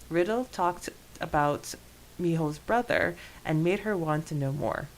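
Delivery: ordinary talk, nothing marked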